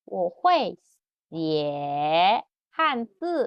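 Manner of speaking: "Wo hui xie hanzi" drawn out slowly; very slow model. Shown as a demonstration. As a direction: say each syllable slowly. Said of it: "Bu hui nggak bisa".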